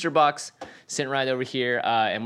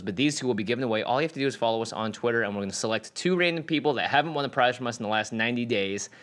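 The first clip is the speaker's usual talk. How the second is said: normal talking voice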